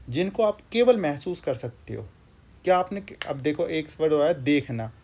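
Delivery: ordinary talk, nothing marked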